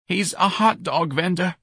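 slow, grieving admission; heavy with grief. A narrator's reading. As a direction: drag out the sad news slowly. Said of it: "her voice heavy with grief".

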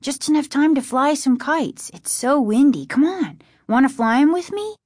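put-on high, eager kid's plea; excited cry. A narrator's reading. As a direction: bubble over with eagerness to go. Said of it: "he cried".

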